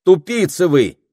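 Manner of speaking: high, loud scornful cry; girl insulting the wolf guard. A narrator's reading. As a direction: fling the insult at him.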